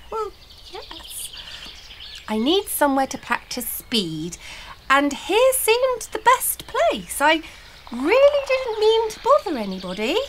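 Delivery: hesitant start, then high polite apology. A narrator's reading. Uncomfortable Blossom excusing herself to the troll leader, polite. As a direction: explain politely, voice lifting high. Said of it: "said Blossom politely".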